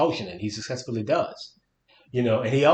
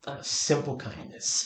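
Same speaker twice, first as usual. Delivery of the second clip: slow whispered aside; whispering; 2 semitones higher, 6 dB quieter, slower than usual. Acted. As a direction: breathe the line out, slowly. Reading a sly line of dialogue.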